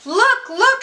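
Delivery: put-on high, loud child's cry; excited shout. A narrator's reading. Child spotting the elves from the train, high excited shout. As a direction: call out, thrilled to see something. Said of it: "shouted one of the children".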